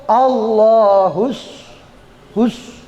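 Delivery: "Allahus" very slow, with a heavy emphatic s on "hus"; sod pronunciation. Shown as a demonstration. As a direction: draw out "Allahus" and repeat "hus" with a thick s. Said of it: "Kalau sod".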